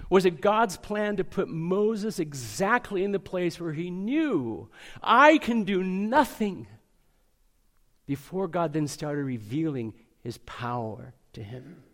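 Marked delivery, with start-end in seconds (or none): 5.0-6.7 emphatic confession. Retold or imitated. Moses admitting helplessness.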